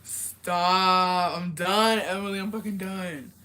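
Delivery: loud, drawn-out protest; amused exasperation. Real, not acted. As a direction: cry out that you can't take it. Overcome by the joke.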